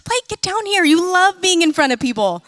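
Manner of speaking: high-pitched mock urging of a reluctant child; retold motherly pleading. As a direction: coax him down like an exasperated mom. Mother calling reluctant son down.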